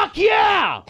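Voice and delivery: high, loud triumphant yell; excited shout. Real, not acted. Host cheers the co-host's perfect whisper, shouting. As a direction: cheer her on at full volume.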